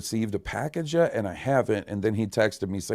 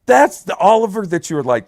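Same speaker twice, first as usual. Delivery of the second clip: normal talking voice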